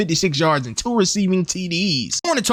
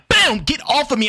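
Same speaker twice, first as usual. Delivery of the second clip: excited shout; 7 semitones higher than usual. Real, not acted. Gamer celebrating a catch over defenders, excited shout. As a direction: explode with the catch, then shove them off.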